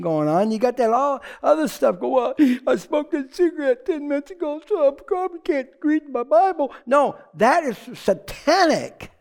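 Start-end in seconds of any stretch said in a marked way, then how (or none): 2.7-6.7 higher, fretting believer's voice; a hint of a self-condemning believer; guilty believer. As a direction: whine it like a worried churchgoer.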